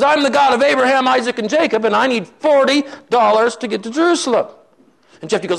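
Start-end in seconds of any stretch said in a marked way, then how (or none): 0.0-4.5 high, insistent declaration; acted-out zealous announcement; fired-up proclamation. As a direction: proclaim it high and loud, all worked up. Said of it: "kind of fired up".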